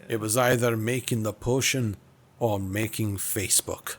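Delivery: measured mock Scottish confession; amateur Scottish character voice; Scottish accent. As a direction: explain yourself in put-on Scottish. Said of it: "I could probably try a bit of Scottish".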